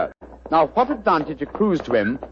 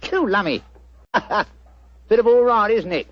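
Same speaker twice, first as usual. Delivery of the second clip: chirpy cockney delivery pitched up; cockney disguise; 5 semitones higher than usual. Acted. Disguised detective greets the homeowner in cockney. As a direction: play a cheeky London workman. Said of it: "What barbaric jargon do you speak?".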